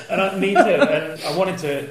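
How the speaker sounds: laughing while agreeing. Real, not acted. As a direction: chuckle through the words.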